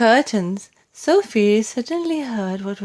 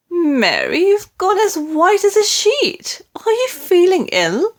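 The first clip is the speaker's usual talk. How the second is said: worried queen; 11 semitones higher, 4 dB louder than usual. A narrator's reading. Queen alarmed at the pale maid.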